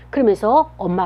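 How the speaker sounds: ordinary talk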